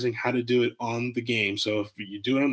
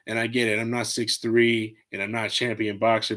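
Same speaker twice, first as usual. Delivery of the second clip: ordinary talk, nothing marked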